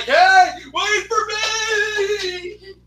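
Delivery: high, long-drawn mock shout; drawn-out high-pitched holler; imitated calling out. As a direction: yell it after a departing train, stretching the last word. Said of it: "they'll be like".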